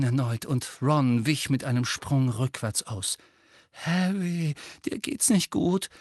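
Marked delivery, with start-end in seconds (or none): 3.7-5.9 high, uneasy friend; worried and tentative; trembling. A narrator's reading. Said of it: "sagte er zittrig".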